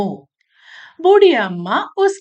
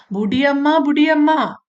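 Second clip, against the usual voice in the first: higher-pitched storybook call; 5 semitones higher, 3 dB louder than usual. Acted. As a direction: lightly act an ant calling to an old woman. Ant calls the old woman.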